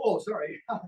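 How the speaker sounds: ordinary delivery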